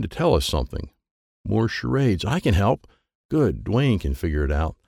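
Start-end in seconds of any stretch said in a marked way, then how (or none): none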